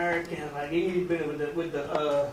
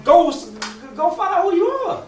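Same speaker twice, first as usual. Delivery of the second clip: loud, higher push to get going; urging shout; 9 semitones higher, 7 dB louder than usual. Acted. The elder hen sending the eagle away, urging shout. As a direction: send him off with a loud, high command.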